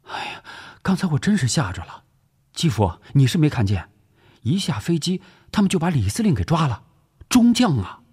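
high, agitated retelling; alarmed. Acted. Wife telling of a commander's arrest at the airport, alarmed. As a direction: exclaim it, still rattled by the shock.

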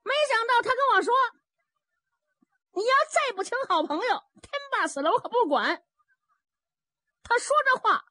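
high-pitched, excitable chatterer; rambling Zhao Bi, agitated. Acted. Zhao Bi reports his mishap to Fourth Master, high and agitated.

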